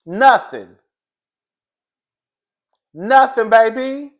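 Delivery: loud, high-pitched crowing; emphatic mockery. Real, not acted. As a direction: belt it out, gloating over being right. Emphatic repeated punchline.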